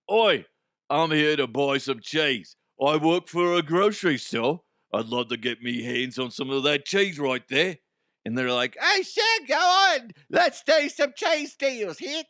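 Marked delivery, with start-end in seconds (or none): 0.1-7.8 hammed-up cheese thief in British-Australian accent; mock British-Australian accent. Acts out the fake buyer. 8.8-12.2 very high, squeaky falsetto seller; comic cheese seller. Pretend.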